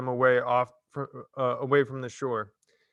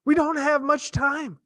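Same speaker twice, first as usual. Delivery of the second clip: urging; 5 dB louder than usual. Acted. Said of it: "urged Catherine".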